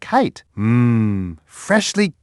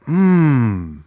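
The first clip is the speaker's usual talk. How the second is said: ordinary talk, nothing marked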